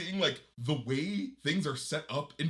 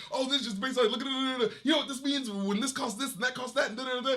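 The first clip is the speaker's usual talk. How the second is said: higher, fast mock rant about the car price; Sinbad fast talk; 9 semitones higher than usual. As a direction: rattle it off, hamming up the comic. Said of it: "he'll just be like".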